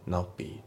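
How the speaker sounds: NOT BE as "no'be"; dropped T. Shown as a demonstration. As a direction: swallow the T in NOT before BE. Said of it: "notのTが抜けますね".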